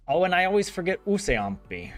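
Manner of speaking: normal talking voice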